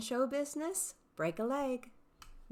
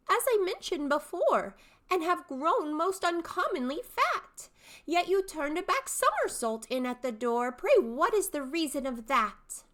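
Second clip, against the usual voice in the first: high, bright-eyed questioning youth; young man's voice; 8 semitones higher, 5 dB louder than usual. Shown as a demonstration. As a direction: pester the old man, pitched high. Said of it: "three distinct character voices".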